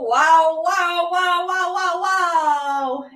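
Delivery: ordinary talk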